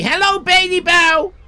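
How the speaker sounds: loud, high-pitched hello; shouted greeting. Real, not acted. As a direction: call out a big welcome. Calling a greeting to arriving viewer.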